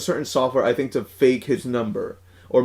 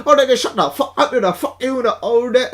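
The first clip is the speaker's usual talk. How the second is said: put-on Vietnamese accent; 9 semitones higher than usual. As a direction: fire back at the caller in a put-on Vietnamese accent. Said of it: "uh, in that accent".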